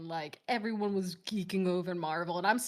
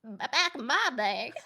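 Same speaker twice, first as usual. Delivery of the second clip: lightly voiced old grandma; a hint of a reminiscing grandma; 4 semitones higher than usual. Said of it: "I gotta put on my grandma voice".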